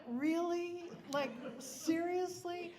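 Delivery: high, loud mock disbelief; incredulous retort. Daughter answering mom on the drifting boat, loudly incredulous. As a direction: retell it as exasperated disbelief, voice leaping up.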